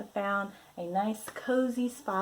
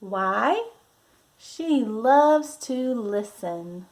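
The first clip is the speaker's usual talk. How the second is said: lively, drawn-out picture-book voice; reading to children; 6 dB louder, slower than usual. Acted. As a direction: read it like a storybook for little ones.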